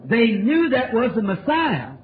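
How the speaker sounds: usual speaking voice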